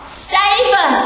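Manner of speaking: high pleading cry; crowd pleading. Retold or imitated. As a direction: lift the pitch like a crowd begging. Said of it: "they're saying".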